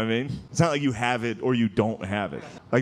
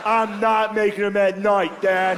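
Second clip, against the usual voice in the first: whiny denial; 6 semitones higher, slower than usual. Retold or imitated. Son denying to his dad.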